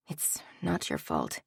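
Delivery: hushed and gentle to the shelves; tender reassurance. Acted. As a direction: soothe it like a hand on a shoulder. Comforting the books softly.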